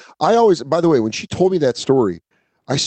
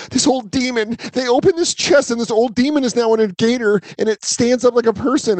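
higher, breathless horror-movie warning; mock frantic believer; 10 semitones higher than usual. Horror film victim warning friends, high frantic mock voice. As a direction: sell the crazy story like you've seen it.

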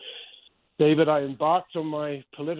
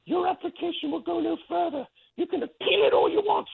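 much higher, mocking Australian accent, haughty and dismissive. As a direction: imitate the Australian director's accent as she turns you down. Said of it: "in her Australian accent".

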